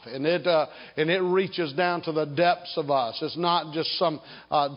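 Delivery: ordinary delivery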